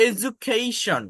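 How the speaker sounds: এডুকেশন said as "e-dju-kei-shon"; careful IPA-style এডুকেশন; IPA pronunciation. Shown as a demonstration. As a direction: sound out এডুকেশন with a j and an ei. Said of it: "এর প্রোনাউন্সিয়েশনটা হচ্ছে".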